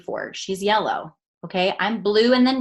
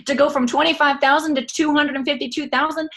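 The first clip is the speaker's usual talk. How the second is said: mock desperate plea; 6 semitones higher, 5 dB louder than usual. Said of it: "I'm like".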